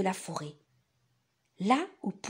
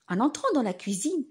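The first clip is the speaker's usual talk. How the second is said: usual speaking voice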